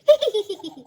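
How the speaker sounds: short real laugh; a quick genuine chuckle. Real, not acted. Laugh after reading.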